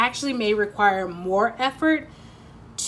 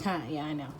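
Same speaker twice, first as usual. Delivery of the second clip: smug, quiet; 5 semitones lower, 10 dB quieter, faster than usual. Retold or imitated. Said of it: "And you're like".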